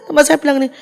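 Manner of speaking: normal talking voice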